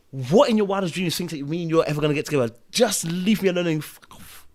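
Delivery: put-on reliving telling the girl off, loud; retold loud telling-off. Schoolboy rejecting a girl in the playground: loud retold telling-off. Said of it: "But I said it loud".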